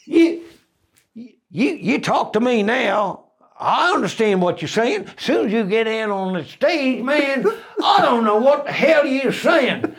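high-pitched, exasperated mock Southern bandsman; Southern US accent. Band member baffled by comedian's stage talk, high-pitched Southern American accent. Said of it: "half of them were ex-[name] band".

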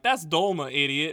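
high, loud mock scolding; loud, exaggerated put-down; scornful correction. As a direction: imitate a local correcting a fool. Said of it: "they're like".

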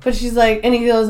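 ordinary delivery

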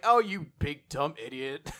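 lightly acted mocking sass; sassy back talk. Severed head scolding her attacker, sassy. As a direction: talk back with teasing scorn. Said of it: "the sassy back talk".

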